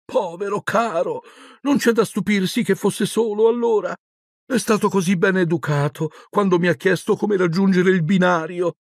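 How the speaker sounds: high, cooing sympathy; high-pitched tender maternal voice; pitying mother. A narrator's reading. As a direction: feel sorry for a poor dear. Mother pities the lonely boy.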